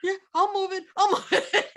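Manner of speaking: high-pitched, laughing mock of the guy; laughing while quoting the cowed trucker; laughing imitation. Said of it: "and the guy goes".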